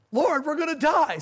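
high, loud panicked wail; panicked cry. Retold or imitated. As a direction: cry out like a drowning man. Panicking disciples in storm.

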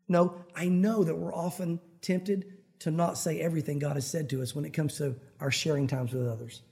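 usual speaking voice

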